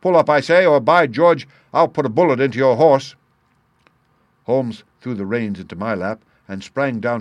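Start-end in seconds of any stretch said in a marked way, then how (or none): 0.0-3.2 loud, high threatening shout. A narrator's reading. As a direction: roar the threat with a gun drawn. Threatening with a pistol.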